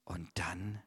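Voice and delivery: suspenseful whisper. Acted. Storyteller whispers before Jesus speaks. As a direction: hush the room.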